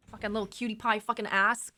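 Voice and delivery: ordinary talk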